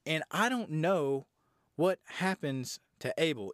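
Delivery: usual speaking voice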